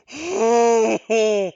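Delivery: loud high startled gasp; sharp gasp. Real, not acted. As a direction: gasp as the turn hangs in balance. Tense make-or-break turn.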